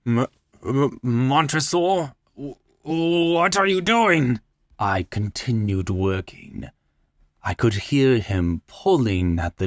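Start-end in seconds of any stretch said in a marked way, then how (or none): 0.0-4.4 stammering in rising fear; trembling stammer. A narrator's reading. Chained man stammers at his captor, frightened. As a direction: stutter the name, voice climbing high.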